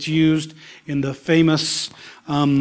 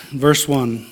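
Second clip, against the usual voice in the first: ordinary talk, nothing marked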